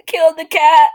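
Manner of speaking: horrified wail. Acted. Horrified reaction to story.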